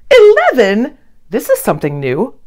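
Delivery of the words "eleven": high, louder astonished eleven; shocked storybook double-take; surprised question. A narrator's reading. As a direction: gasp out the number in disbelief.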